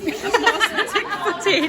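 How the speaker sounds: slow, high, laughing drawl. Real, not acted. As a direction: giggle it out slowly. Laughing, drawn out.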